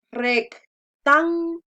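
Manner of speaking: rectángulo in slow separate beats, "rec, tán, gu, lo"; slow syllable demo of rectángulo, punched "tán". Shown as a demonstration. Teacher splitting word into syllables.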